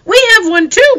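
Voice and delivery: put-on very high, loud girls' cry; older sisters crowding in for attention; excited cry. A narrator's reading. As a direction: squeal it out eagerly. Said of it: "they cried".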